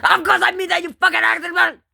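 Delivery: high, fast outraged yell; screaming. Acted. Answering the one who asked about Dandy's World, screaming. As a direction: scream the insult in a high-pitched rush. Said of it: "stop fucking screaming".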